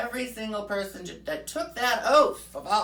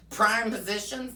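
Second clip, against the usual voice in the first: ordinary delivery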